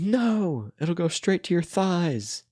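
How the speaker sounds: high, slow, sing-song cartoon warning; SpongeBob voice. SpongeBob teasing Squidward about his thighs, high and slow. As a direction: stretch each word out like a smug cartoon sponge. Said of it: "SpongeBob goes".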